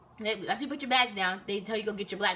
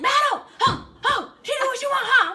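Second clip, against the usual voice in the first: very high, loud shouting; furious sergeant imitation; 10 dB louder, slower than usual. Sergeant singling out inattentive recruit, high mock scream. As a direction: scream the commands like a drill sergeant. Retold sergeant yelling commands.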